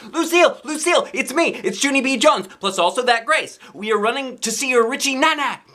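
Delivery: high, loud eager call across the lot. Acted. Girl calling to Lucille on arrival, high excited call. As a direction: shout her name as you run up.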